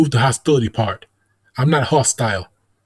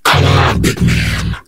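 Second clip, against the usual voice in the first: hoarse, whispery demon growl; 4 dB louder, much slower than usual. Pretend. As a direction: speak like a possessed demon. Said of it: "activated my demon voice".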